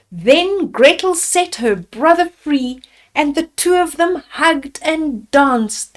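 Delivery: ordinary delivery, nothing marked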